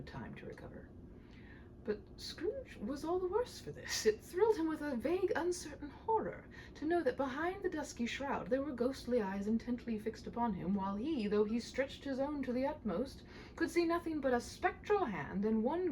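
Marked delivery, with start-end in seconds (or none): none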